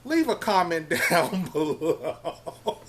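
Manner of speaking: slow, laughing request to viewers. Real, not acted. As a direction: break into laughter through the words. Amused by the pronunciation dispute.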